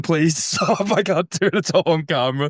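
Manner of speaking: high pleading through laughter; laughing plea. Retold or imitated. Narrator begging the group to stop, high and laughing. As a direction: beg them to stop while cracking up. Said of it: "I'm screaming".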